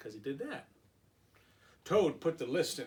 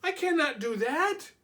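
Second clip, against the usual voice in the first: high, louder stubborn Toad; 11 semitones higher, 5 dB louder than usual. Acted. Toad refusing Frog, higher.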